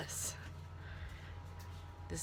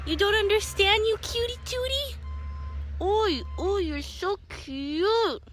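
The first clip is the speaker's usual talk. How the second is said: much higher, cooing pet voice; 13 semitones higher than usual. Real, not acted. Baby talk to the cat.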